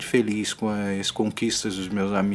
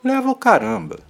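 high, loud and scornful; indignant; 13 semitones higher, 6 dB louder than usual. Real, not acted. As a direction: throw it back in scorn. Indignant retort to a saying.

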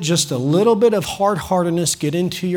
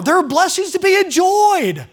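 preaching; 11 semitones higher, slower than usual. Real, not acted. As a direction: preach it with rising joy. Preaching at full force.

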